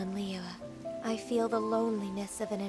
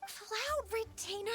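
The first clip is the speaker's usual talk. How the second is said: high, drawn-out embarrassed whine; mortified protest; 13 semitones higher than usual. Acted. Ganyu protesting Cloud Retainer's childhood tales, mortified. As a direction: beg her to stop, pitched high and slow.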